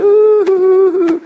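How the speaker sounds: high, loud mock sobbing "uuu-hu-hu"; hammed-up wail of someone overcome with grief. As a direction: put on a big pulsing fake cry. Mimics someone sobbing with excessive grief.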